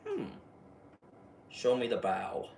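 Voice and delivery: menacing low clown request; Pennywise voice. Retold or imitated. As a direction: purr it like a patient monster. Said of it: "Pennywise was like".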